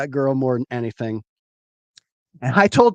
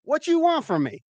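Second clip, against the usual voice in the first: high-pitched mock exasperation; exasperated retort; 10 semitones higher than usual. God answering the castaway's complaint, exasperated retort. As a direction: fire back incredulously, voice pitched up.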